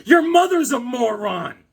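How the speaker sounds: high, loud, slow hammed-up comeback; mock retort. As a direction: fire it back at the troll. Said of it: "I'm like".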